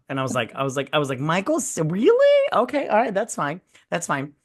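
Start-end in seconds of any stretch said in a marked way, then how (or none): 1.2-4.3 squeaky high disbelief; incredulous. Retold or imitated. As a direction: shriek the disbelief, then deflate. Mock reaction to Mindy's plan.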